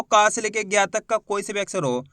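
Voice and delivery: normal talking voice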